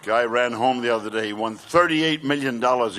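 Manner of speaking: normal talking voice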